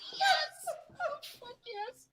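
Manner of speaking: high, cracking squeal of joy; ecstatic shout. Real, not acted. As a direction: celebrate like you just won. Reviews found, overjoyed.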